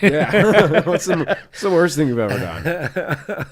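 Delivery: real laughter into a slow, loose question; laughing. Real, not acted. Man mocking his own worst moment, laughing.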